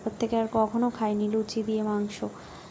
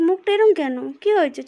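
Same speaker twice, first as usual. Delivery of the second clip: put-on baby talk; 7 semitones higher, 7 dB louder than usual. Fussing over the baby.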